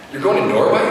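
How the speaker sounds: amazed, can't believe it; surprised. Retold or imitated. Retelling grandma's surprising news.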